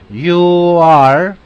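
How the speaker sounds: careful full "You are" model. Shown as a demonstration. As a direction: say "You are" slowly, each word clear. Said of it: "la forma larga".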